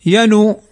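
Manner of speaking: ينو as "yanu", u after the n; noon with damma. Shown as a demonstration. Teacher showing what we do not say, ينو ending in u. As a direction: add a short u to the noon in ينو. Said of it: "لم نقل".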